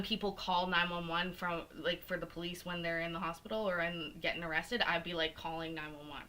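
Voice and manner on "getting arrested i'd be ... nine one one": normal talking voice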